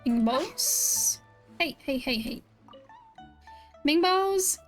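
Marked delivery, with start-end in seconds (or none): none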